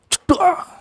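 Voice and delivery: high, sharp yelp of a thud; imitated bang. A bang as the nephew kicks her into the door. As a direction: blurt the slam sound in a high voice.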